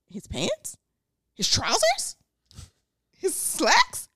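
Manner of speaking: high, soft, arch questions; playful put-on query; mock questioning. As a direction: try each word out ironically, lifting the pitch. Acts out ironic word choices.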